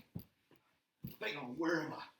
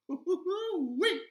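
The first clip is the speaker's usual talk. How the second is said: gleeful whoop; 4 dB louder, much slower than usual. Acted. Gleeful show-opening cheer.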